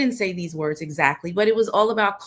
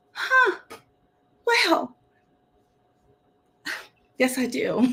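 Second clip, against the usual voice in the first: mock realization; 6 semitones higher, slower than usual. Retold dawning acceptance.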